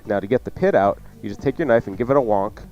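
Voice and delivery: ordinary delivery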